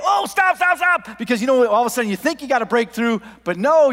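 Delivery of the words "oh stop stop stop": high, loud mock panic; panicked yell. Worker seeing the pipe back up, panicked yelling. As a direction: yell to halt it right now. Retold panic as sewage backs up.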